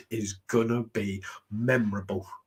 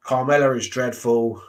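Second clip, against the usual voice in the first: ordinary talk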